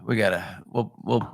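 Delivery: usual speaking voice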